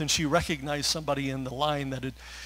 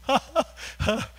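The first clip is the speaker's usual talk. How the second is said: choked laugh; 9 semitones higher than usual. Real, not acted. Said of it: "Sorry for getting emotional right there".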